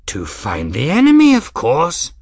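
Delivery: loud exclamation climbing high; excitable old man. A narrator's reading. Old man explaining his need for the ship, excitedly. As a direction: exclaim it as utterly obvious.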